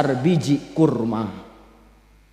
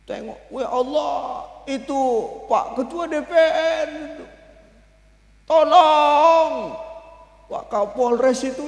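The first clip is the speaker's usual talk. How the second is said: weeping Prophet; 6 semitones higher, slower than usual. Acted. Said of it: "Nangis Rasulullah".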